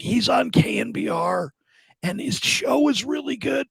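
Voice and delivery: imitating a weak patient, high and strained; barely able to talk. Sick dad promotes son's show to nurse, barely able to talk. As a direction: push out a proud boast in a high, feeble voice. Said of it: "he's barely can talk".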